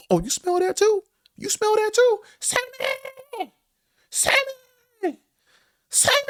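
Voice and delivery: put-on very high, loud old-lady voice, yelling out a name; grandma hollering upstairs to pick a fight; shrill grandma call. Grandma calling her son down, very high and loud.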